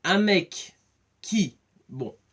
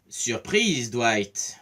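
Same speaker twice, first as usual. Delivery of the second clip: ordinary delivery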